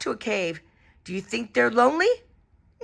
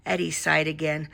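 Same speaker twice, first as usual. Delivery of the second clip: ordinary delivery, nothing marked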